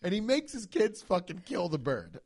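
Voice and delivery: ordinary delivery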